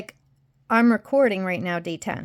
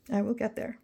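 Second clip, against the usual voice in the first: softer, "will" rising to a questioning end; quiet repeat; 6 dB quieter than usual. Shown as a demonstration. To viewers, quietly doubting her own promise. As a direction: say "will" softly and let it rise.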